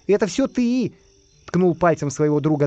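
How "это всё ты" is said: high-pitched accusation; accusing spy. A narrator's reading. Spy pokes his friend, high accusing voice. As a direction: blame him in a high voice.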